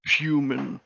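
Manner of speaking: lightly voiced two-headed giant; two bickering giant voices. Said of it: "It's one every other word".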